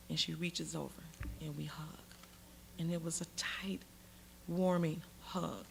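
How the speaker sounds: soft, low, intimate storytelling; hushed, moved. Real, not acted. Storyteller recalling the hug with Clinton, hushed and moved. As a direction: let it sink almost to a murmur. Tender climax of her story.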